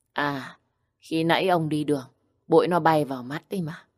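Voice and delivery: ordinary delivery, nothing marked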